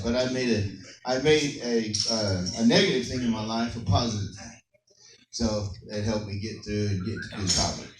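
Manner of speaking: ordinary talk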